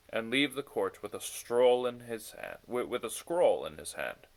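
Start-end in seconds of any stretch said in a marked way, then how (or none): none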